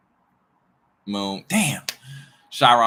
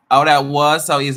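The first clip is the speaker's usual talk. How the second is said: louder, brighter mock of the listener; mock smug listener; 6 semitones higher, 12 dB louder than usual. Mocking the smug viewer, loud and showy. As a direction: throw the smug line out loud.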